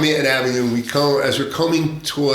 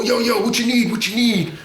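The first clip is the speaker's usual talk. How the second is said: lightly voiced street dealers, higher pitch; dealer calling out; 10 semitones higher than usual. Street dealers hailing undercover cops, high mock call-out.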